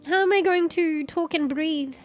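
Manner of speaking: ordinary talk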